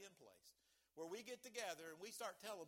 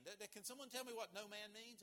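ordinary talk

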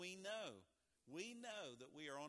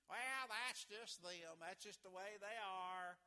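slow, higher, dismissive shrug of a voice; mock excuse-maker; 5 semitones higher, slower than usual. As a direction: imitate someone excusing bad behavior, drawn out. Mocking excuse for pessimists.

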